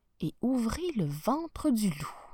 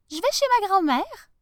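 put-on little girl voice; 11 semitones higher, 7 dB louder than usual. Little girl answers the wolf.

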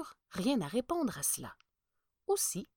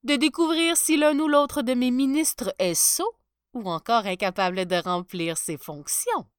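louder sly sneer; 4 semitones higher, 8 dB louder than usual. Acted. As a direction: gloat over the coming test. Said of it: "ricana-t-il".

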